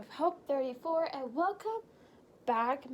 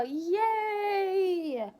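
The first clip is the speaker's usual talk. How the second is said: excited cheer; 6 semitones higher, 7 dB louder than usual. Real, not acted. Cheering for the packing video.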